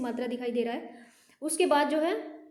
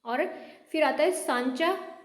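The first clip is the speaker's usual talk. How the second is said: ordinary talk, nothing marked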